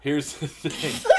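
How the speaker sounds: slow, laughing through the words; can't hold back a laugh. Real, not acted. Repeats line, laughing through it.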